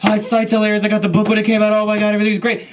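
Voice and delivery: loud, high mock gusher; nonstop gushing. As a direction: bulldoze on in loud excitement without a gap. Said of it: "He just can't stop talking".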